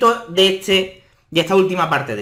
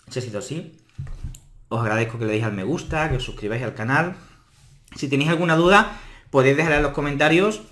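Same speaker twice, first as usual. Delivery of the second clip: talking around a hard candy in mouth; sucking a hard candy; 3 semitones lower, 3 dB quieter, faster than usual. Real, not acted. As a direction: speak with a sweet tucked in the cheek. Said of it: "he estado grabando el vídeo con un caramelo en la boca".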